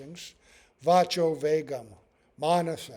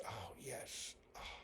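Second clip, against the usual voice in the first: sleepy whisper; 7 semitones lower, 24 dB quieter, slower than usual. Acted. Whispering as someone drifting happily off to sleep. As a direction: breathe it out in a drowsy whisper. Blissful sigh at sleep's relief.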